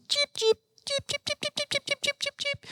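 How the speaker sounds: tiny, very high, quiet chirps; bird chirping. Acted. Uncle voices the little bird starting to sing, high chirping. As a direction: imitate a little bird with rapid piping cheeps. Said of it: "that little bird started to sing".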